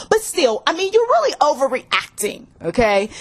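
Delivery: high-pitched, breezy brush-off; cheating partner brushing off the fight in a high voice; defensive dismissive partner. Acted. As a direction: wave away the accusation, talking fast and high. Guilty partner brushing off accusation.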